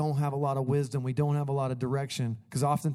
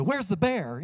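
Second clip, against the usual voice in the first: put-on higher, eager kid asking; like a four-year-old; 6 semitones higher, slower than usual. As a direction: blurt it out like an excited little kid. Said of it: "act like a four-year-old and go".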